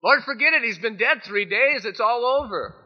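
loud high pleading protest; exasperated plea. Acted. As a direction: beg him to give up on it. Martha pleading, voiced loudly.

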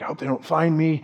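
mild mock fear, dropped soft; scared. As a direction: say it quietly, afraid of being found. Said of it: "But he's also scared".

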